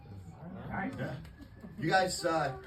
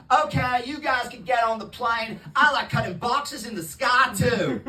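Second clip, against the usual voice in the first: a lightly louder Southern Gump voice; Forrest Gump accent; 2 semitones higher, 5 dB louder, slower than usual. Pretend. Comic voices Gump at his airport job, light Southern drawl. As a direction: slowish hint of Gump drawl. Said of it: "you can't do that accent".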